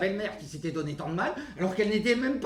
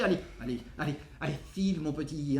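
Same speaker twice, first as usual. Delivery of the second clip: low, soft, deflated send-off; resigned dismissal; 6 semitones lower, 6 dB quieter, faster than usual. Acted. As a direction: wave him away quietly after the outburst. Mother wearily dismisses her son.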